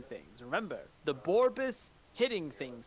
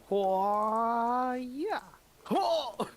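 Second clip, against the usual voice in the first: drawn-out rising "hwa-ya", then a high "hwa"; high mock martial-arts cry; battle cry; 6 semitones higher, 4 dB louder than usual. Player showing the DM the character is ready to go, battle cry. Player acts out readiness to fight.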